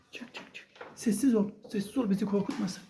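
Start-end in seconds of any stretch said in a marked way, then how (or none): 0.1-0.7 whispering. Pretend. Hiding from monster in game.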